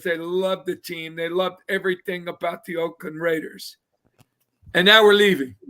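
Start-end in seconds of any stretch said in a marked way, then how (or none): 4.7-5.6 now punched loud, falling; indignant loud retelling; loud outburst. Real, not acted. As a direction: blurt it out in disbelief. Outrage at team leaving.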